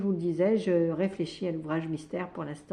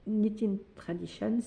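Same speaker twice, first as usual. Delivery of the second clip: "Knitting Traditions" said the English way, with "Knitting" rising; a mock-proud attempt at an English accent on "Knitting Traditions"; self-mocking English accent; 2 semitones higher than usual. Shown as a demonstration. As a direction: try out your best English pronunciation of "Knitting Traditions", half joking. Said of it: "avec mon super accent".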